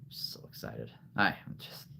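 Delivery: whispered, hushed thrill; breathless excitement. Real, not acted. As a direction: gush under the breath. Gushing about new figures.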